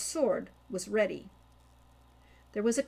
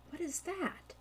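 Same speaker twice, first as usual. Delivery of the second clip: hushed question; 8 semitones higher, 8 dB quieter than usual. Acted.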